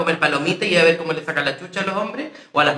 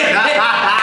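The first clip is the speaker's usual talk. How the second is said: loud laugh; 4 semitones higher, 4 dB louder than usual. Real, not acted. Bursts out laughing.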